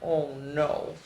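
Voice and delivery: ordinary delivery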